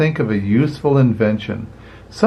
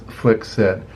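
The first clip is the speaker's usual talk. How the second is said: ordinary delivery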